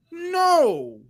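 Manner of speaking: high, drawn-out exasperated "no"; retold emphatic no. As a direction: replay an incredulous, high-pitched denial. Exasperated denial in retold quarrel.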